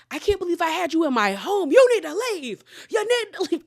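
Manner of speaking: high, loud imitated fury; mock-furious rising yell; Meredith. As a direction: act out her kicking someone out. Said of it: "Meredith was pissed".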